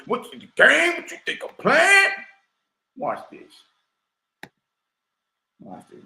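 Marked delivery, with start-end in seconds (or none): 0.1-2.1 mocking taunt. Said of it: "Y'all think". 3.0-3.5 hushed tease. Real, not acted. Dropped to a hush.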